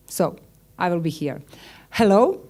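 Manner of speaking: usual speaking voice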